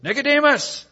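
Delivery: very high, put-on exclamation; Craig. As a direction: imitate an outraged cry of the name. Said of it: "I can still hear Craig say it".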